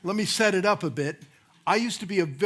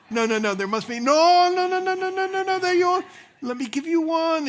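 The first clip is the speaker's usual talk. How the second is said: put-on Cuban accent, higher and building louder; an eager Cuban stranger insisting, pitch climbing; excited Cuban accent; 9 semitones higher, 4 dB louder than usual. Cuban man pressing a cigar on a pastor, loud high Cuban accent. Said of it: "a man comes up with a Cuban accent".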